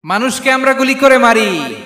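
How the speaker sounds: emphatic outcry. Real, not acted. Preacher repeats his point.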